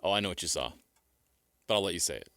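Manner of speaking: ordinary talk, nothing marked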